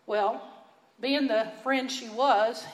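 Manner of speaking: ordinary talk, nothing marked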